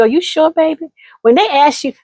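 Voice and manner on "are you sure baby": elder's gentle doubt. Retold or imitated. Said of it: "and they say to you".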